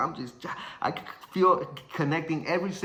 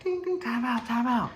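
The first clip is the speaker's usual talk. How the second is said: much higher-pitched official's call; referee calling time; 10 semitones higher than usual. Retold or imitated. Ref stopping the bout for a bleeding fighter, high call. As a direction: imitate the bell, then call a halt up high.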